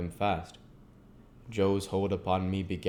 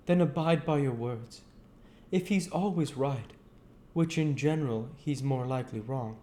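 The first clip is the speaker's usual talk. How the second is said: higher-pitched Joe, earnest and homely; Joe's voice; 7 semitones higher than usual. Acted. Joe talking gently to old Pip, in a higher voice. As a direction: pitch up into Joe, a plain simple man.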